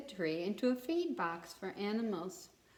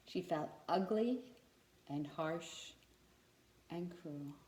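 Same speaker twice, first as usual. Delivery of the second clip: each word softer and lower; fading sadness; 4 semitones lower, 6 dB quieter than usual. A narrator's reading. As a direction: let it sink into sorrow. Tree's sorrow at crucifixion.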